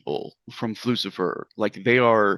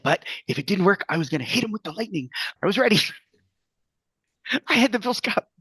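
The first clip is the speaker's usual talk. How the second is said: put-on eager, high-pitched kid voice; excited child bragging, voice raised high; super excited; 9 semitones higher, faster than usual. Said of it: "super excited".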